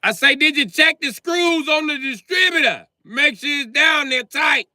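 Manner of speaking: shouting the question a third time into the phone; shouting over phone. Acted. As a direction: yell it down a bad phone line.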